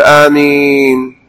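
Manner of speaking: آمين with the long first vowel stretched slowly; drawn-out amin. Shown as a demonstration. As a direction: draw out the aa of آمين. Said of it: "فقل". (آمين بمد طويل)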